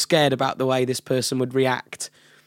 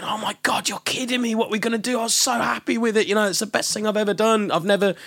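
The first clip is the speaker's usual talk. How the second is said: freaking out; 8 semitones higher than usual. Retold or imitated. Imitating the artist hearing her record is lost, freaking out. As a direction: mimic someone upset, pitched well up. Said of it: "she'd be freaking out".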